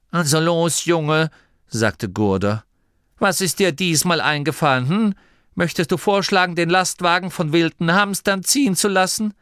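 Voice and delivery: high, loud, sarcastic questioning; Gurder, mocking elder. A narrator's reading. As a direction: needle the boy with mock interest. (spöttisch, laut und hoch nachfragen)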